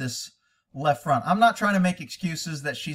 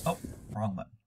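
ordinary talk

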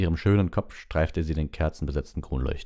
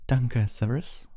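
firm, slow and higher pitched; Dumbledore; 8 semitones higher, slower than usual. A narrator's reading. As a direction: cut in firmly, unhurried. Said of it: "sagte Dumbledore mit fester Stimme". (mit fester Stimme, langsam)

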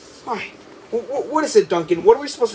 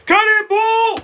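higher, louder cannonball shout; excited shout; 13 semitones higher, 6 dB louder, slower than usual. Acted. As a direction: yell it as you hurl it. Shouting a big throw.

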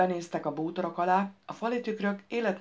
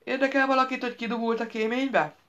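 high, louder pointed question; insistent, raised-pitch query; inquiring; 7 semitones higher, 3 dB louder than usual. A narrator's reading. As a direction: call out to get their attention. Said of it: "firtatta Roscoe".

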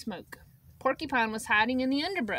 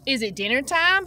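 raised, louder call; 2 semitones higher, 7 dB louder than usual. A narrator's reading. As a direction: call it out across the field. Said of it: "he called".

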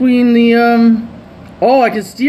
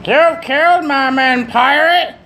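high, slow put-on merchant; slow high mock trader; silly merchant voice; 7 semitones higher, slower than usual. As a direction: drawl it like a cartoon merchant. Voicing merchant dialogue.

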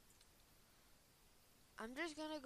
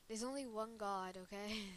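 ordinary delivery

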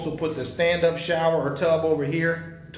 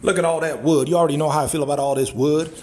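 usual speaking voice

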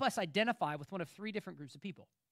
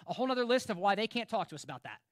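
ordinary talk, nothing marked

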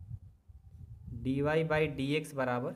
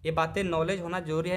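usual speaking voice

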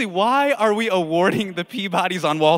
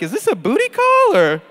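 mock bewilderment; 6 semitones higher, 4 dB louder than usual. Imagined winner reacting to text.

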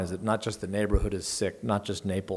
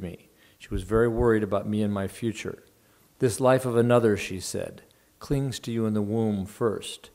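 steady, lightly animated reading voice; literary reading to an audience; story reading; 6 dB louder than usual. A narrator's reading. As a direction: read the novel aloud to the room. Reads novel passage aloud.

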